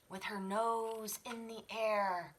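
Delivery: plain reading voice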